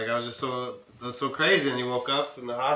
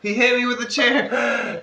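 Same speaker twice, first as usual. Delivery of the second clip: mock whiny wrestler; 10 semitones higher, slower than usual. Imitating Eddie Guerrero faking injury.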